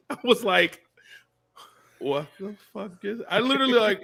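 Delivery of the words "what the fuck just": high, hushed bewildered voice; freeze, then squeak it out softly; stunned disbelief. Retold or imitated. Retelling his stunned reaction.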